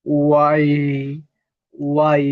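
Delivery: ওয়াই with rounded lips, slowly; rounded, drawn-out ওয়াই; round sound. Shown as a demonstration. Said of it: "এটা হবে round sound".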